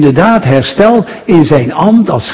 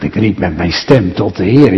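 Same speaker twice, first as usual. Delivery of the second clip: lower, softer, wondering repeat of the verse; a listener's mild, quiet puzzlement; puzzled listener; 8 semitones lower, 3 dB quieter than usual. Retold or imitated. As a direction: echo it like a doubtful listener. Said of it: "U zegt".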